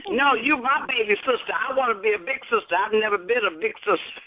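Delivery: high, bubbly, teasing insistence; playful insistence. Real, not acted. Claiming to be the big sister to her friend, high and teasing.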